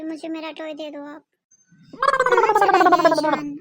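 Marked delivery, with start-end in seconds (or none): 1.9-3.6 loud, drawn-out searching call. Acted. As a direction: call out slowly and loudly for a missing brother. Wondering loudly where brother went. (ज़ोर से, खींचकर पुकारते हुए)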